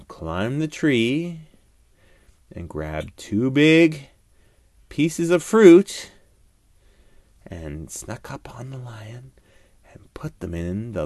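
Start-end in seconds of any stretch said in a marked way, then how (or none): none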